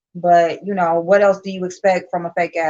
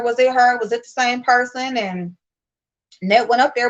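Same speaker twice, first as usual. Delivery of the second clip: normal talking voice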